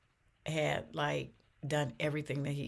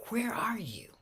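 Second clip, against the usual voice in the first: hushed plea; 5 semitones higher than usual. Retold or imitated. Retold anguished prayer to God.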